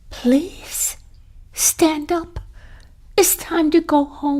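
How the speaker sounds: high, tender coaxing; gentle plea. Acted. Wife gently pleading with Carl, high and tender.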